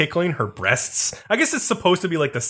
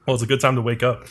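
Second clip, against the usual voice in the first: ordinary delivery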